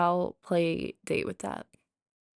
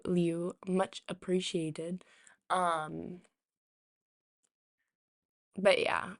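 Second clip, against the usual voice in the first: usual speaking voice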